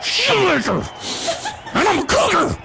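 put-on high falsetto boy trying to sound tough; kid acting tough. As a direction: squeak it out with mock toughness. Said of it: "I wanted them to know that I was tough".

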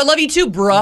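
mock-casual bro reply; buddy-like. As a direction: imitate a chummy, offhand sign-off. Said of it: "like I'm your bro".

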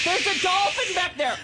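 loud, high, laughing announcement; laughing radio drama shout. Pretend. Playing a radio drama scene.

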